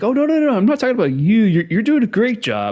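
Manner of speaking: high mock reassurance; fawning manager. Manager sucking up to the employee who leaves. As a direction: gush high at the one employee. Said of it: "and it's like".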